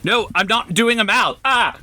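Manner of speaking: high-pitched, panicky protest; protesting refusal. Acted. Player resisting being hauled onto the animal's back, high protesting yelp.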